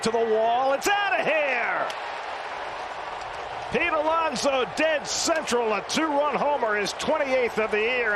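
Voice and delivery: home run call. Real, not acted. Ball carrying over the wall.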